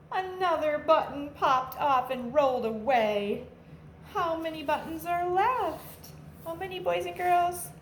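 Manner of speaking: put-on lively storybook read-aloud for kids; animated picture-book narration for a class; reading to children. A narrator's reading. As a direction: read it like a teacher at story time, bouncy and clear.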